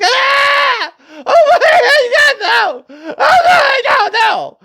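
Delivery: high, loud wailing mock scream; over-the-top shrieking sobs, words dragged out; imitated crying scream. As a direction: wail it out like a hysterical child. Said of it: "I hear her scream and start crying".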